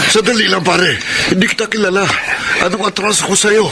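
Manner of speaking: high, panicky pleading; scared man pleading with an attacker; frightened pleading. Acted. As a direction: back away begging from the knife. Man threatened with a knife.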